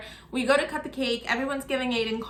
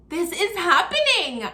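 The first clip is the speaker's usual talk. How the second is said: stunned; 7 semitones higher, 5 dB louder than usual. Retold or imitated. Seeing him kneel to propose.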